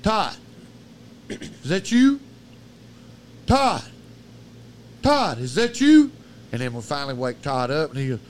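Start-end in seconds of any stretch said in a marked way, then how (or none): 0.0-6.1 high, loud call into the dark. Retold or imitated. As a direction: call nervously for a friend, rising. Retold calling out in the dark.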